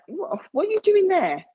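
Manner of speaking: high, startled question from him; loud, high disbelieving what; surprised quote. Retold or imitated. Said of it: "he'd be like".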